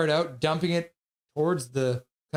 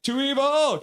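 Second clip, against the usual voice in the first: answering toast; 8 semitones higher, slower than usual. Retold or imitated. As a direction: shout back the toast, a touch of mock. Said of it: "And I'm like".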